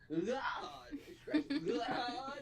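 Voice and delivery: lightly put-on grown-folks tone, pushed out; grown people voice. Shown as a demonstration. Telling the chat about God in a lightly grown-up voice. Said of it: "I'ma say it in a grown people voice".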